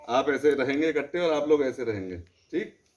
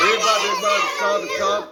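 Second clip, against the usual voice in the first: raised voice cutting in on the class; calling stop; 5 semitones higher, 5 dB louder than usual. Real, not acted. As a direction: call out to halt the chanting. Teacher stops the chanting class. (बच्चों को रोकते हुए ऊँची आवाज़ में टोकना)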